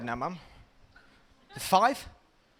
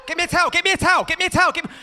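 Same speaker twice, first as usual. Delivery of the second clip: high loud repeated towel demand; pleading child; 11 semitones higher, 7 dB louder, much faster than usual. Retold or imitated. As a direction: beg frantically with soap in eyes. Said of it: "child in bath".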